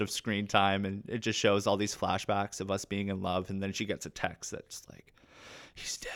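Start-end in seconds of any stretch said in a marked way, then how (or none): 5.7-6.1 very soft, low-volume delivery; hushed bad news. Actor relaying a film's death text, hushed. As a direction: drop it almost to nothing.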